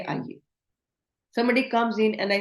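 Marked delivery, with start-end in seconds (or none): 0.0-0.4 soft, subdued word of welcome; understated, quiet invitation to come in; low-key greeting. Shown as a demonstration. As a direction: say it quietly, without any lift. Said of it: "but I changed my tone of voice".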